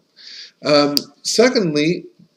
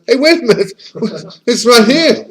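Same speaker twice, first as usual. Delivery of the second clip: higher, louder retold exclamation; excited discovery; 8 semitones higher, 6 dB louder than usual. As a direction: act out suddenly spotting it, laughing between phrases. Retold moment of discovery on boat.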